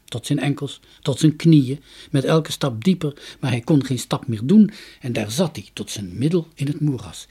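plain reading voice

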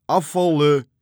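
loud, high yell across the water; shouted command. A narrator's reading. Arie shouts an order from the other boat, yelling. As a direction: bellow it as a sailing order. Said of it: "schreeuwde kromme Arie".

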